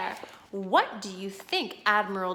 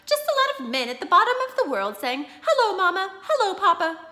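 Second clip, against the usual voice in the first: mocking; 11 semitones higher, 7 dB louder than usual. Mocking the broadcast.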